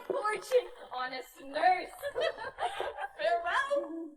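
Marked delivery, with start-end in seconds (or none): 2.0-3.1 high cackle. Acted. Nurse laughs after teasing.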